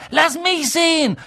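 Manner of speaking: put-on high-pitched boyish insistence; demanding. A narrator's reading. Dudley demands to see the letter, insistent. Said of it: "verlangte Dudley".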